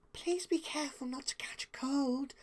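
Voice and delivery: soft, breathy whisper; whispered with a smile. Acted. Fluttershy to Pinkie, gentle whispered warning. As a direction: whisper it kindly. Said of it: "said with a small smile".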